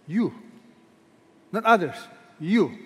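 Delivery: single out You, not others; stress on You. Shown as a demonstration. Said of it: "That's emphatic".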